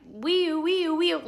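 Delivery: high-pitched wailing wee-oo siren; siren imitation. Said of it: "It wasn't going like".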